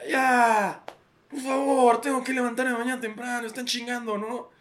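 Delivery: put-on high-pitched weary father begging the kids to stop; fed-up dad pleading with fighting kids; tired dad. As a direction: whine like a worn-out dad at bedtime. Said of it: "como este papá cansado".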